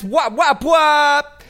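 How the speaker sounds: high, loud popping mouth sound. Acted. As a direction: pop it out like a DJ drop. DJ sound effect drop.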